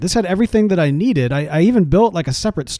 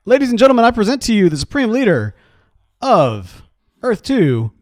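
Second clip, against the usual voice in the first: announcer; 5 semitones higher than usual. Acted. Crowning the new leader.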